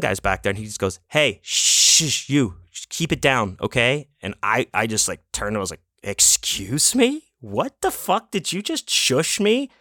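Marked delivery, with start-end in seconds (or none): 1.1-4.1 higher-pitched scolding shush. Retold or imitated. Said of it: "I got shushed by the sound man". 6.0-9.7 high, slow incredulous retort; acted-out outrage at being shushed; indignant.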